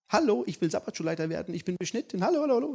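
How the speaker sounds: high, fast, hammed-up boasting; preacher mocking a show-off church member. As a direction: parody a bragging volunteer. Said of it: "mock boastful candidate".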